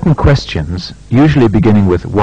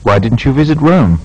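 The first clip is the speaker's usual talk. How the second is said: "Why didn't you come last week?" rising steadily, short fall on "week"; a frank, polite question that climbs and dips at the end; "Why didn't you come last week?" with a continuous rise and a final fall; rising tune, short final fall; 4 dB louder than usual. Shown as a demonstration. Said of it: "Make similar questions using why or how".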